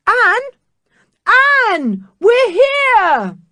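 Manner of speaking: high loud calls through the wall; shouting. Acted. The children to Anne, loud high shouts. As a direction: call out to be found.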